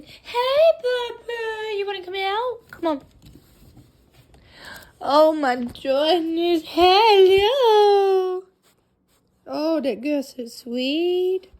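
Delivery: very high, slow squealing coo. Real, not acted. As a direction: squeal with delight over the puppy. Greeting the puppy coming out.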